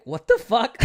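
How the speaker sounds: loud shocked outburst; shocked shout. Retold or imitated. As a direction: blurt it out, stunned. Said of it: "You'll be like".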